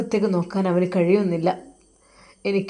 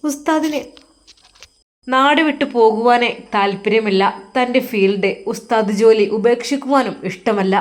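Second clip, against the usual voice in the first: louder, pressing storyteller's climax; dramatic story narration; 5 dB louder than usual. A narrator's reading. Narrator telling of Usthad's breaking heart, loud and dramatic. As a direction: push the narration up with rising emotion.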